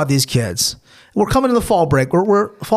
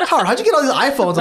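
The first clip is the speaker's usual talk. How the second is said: rattled off fast and a touch higher; quick, slightly raised mock question; quick mock quote; 5 semitones higher, faster than usual. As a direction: fire off the nosy question quickly. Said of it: "It's like".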